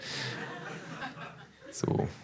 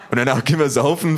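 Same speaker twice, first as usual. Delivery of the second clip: loud, fast laughing ask; big thug asks about drinking, laughing; eager laughing question; 8 semitones higher, 9 dB louder, faster than usual. Acted. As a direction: ask with glee.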